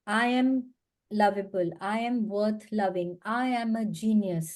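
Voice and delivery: every line spoken slowly and evenly; slow affirmations. Shown as a demonstration. Coach reading out affirmations from the book, slow. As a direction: let each affirmation land slowly. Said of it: "mirror के सामने खड़े होके उसको बार बार बोलेंगे".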